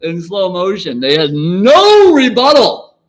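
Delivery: high, loud, slow gloating; slow excited brag. Real, not acted. Telling viewers about debate rivals with slow, loud gloating. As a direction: stretch it out like a slow-motion win.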